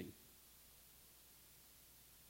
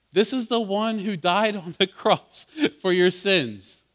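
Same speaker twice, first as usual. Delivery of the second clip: louder, higher, driving preacher's cadence; preaching at full force; 6 semitones higher, 7 dB louder than usual. Acted. Preacher exhorting his congregation about Christ's sacrifice, at full force. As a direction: build it up like a fiery pulpit climax. Climax of the sermon.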